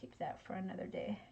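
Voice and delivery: whispered aside; whispering. Real, not acted. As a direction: whisper it to yourself. Aside while crafting on live.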